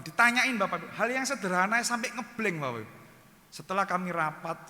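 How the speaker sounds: ordinary talk, nothing marked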